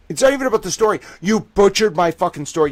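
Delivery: loud, high, indignant rant; angry rant. Real, not acted. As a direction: blast them for the butchered story. Ranting at the newspaper.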